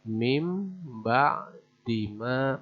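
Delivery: "Mim ba di ma" slow, with m before b; iqlab reading. Shown as a demonstration. Teacher recites the example slowly for learners. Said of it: "diganti menjadi mim".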